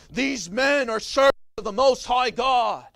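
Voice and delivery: loud, strained proclamation shout; retold shrieking yell. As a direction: yell it to the whole town like a street prophet. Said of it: "She's yelling at the top of her voice".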